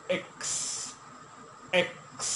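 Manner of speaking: "এক্স" with ক tinged toward খ, long s; slight kh sound. Shown as a demonstration. Teacher modelling the letter X for repetition, "এক্স" with slight kh. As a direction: say "এক্স" between k and kh, drawing out the s. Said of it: "খও হবে না".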